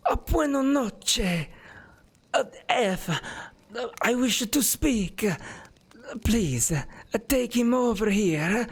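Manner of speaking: put-on high, slow, strained old man's voice; frail ancient noble speaking slowly and high; frail old man in a wheelchair. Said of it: "his wheelchair kind of creaks as he looks and goes".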